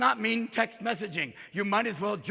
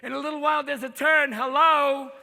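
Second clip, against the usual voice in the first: put-on higher-pitched kvetching old navigator; cranky, sing-song elderly GPS voice; 4 semitones higher than usual. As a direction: nag the driver like an aggrieved oldster. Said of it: "maybe you should have the old G P S".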